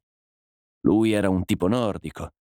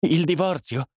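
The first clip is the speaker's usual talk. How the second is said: choked, higher gasp of a question; the word catches in her throat; wife stunned by the offer of divorce, choked; stifled exclamation; 8 semitones higher than usual. A narrator's reading. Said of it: "esclamò la donna con voce soffocata".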